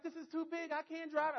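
very high imitation of tearful wife; mock crying. Wife overwhelmed by the big truck, mock crying. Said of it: "she's crying, right?".

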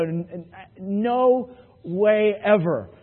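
slow, stuttering "no way" at the cop; stammering disbelief. Retold or imitated. Driver stammering at the cop who pulled him over, slow disbelief. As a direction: stammer it out, caught off guard.

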